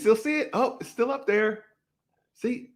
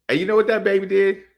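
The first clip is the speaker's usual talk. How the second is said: ordinary delivery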